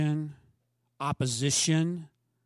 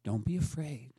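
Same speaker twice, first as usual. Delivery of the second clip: tender reassurance; 6 semitones lower, 7 dB quieter than usual. Acted. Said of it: "tender closing echo".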